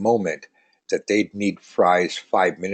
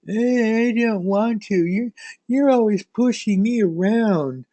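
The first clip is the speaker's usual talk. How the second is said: put-on much higher, whiny teenage son complaining to his parents; 9 semitones higher than usual. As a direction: sulk like a teenager being pushed to work. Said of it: "Can you imagine dragging this guy".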